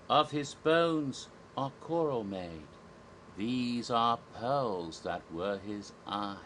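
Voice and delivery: slow, measured Shakespearean verse; poetry recitation. Acted. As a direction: recite it grandly, stretching each line with long pauses. Recites Ariel's song from Shakespeare.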